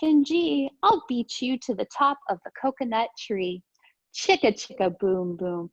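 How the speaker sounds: lively storybook read-aloud; sing-song picture book reading; reading to children. Acted. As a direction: read it bouncy for little kids. Reads picture book to class.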